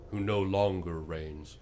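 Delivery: ordinary delivery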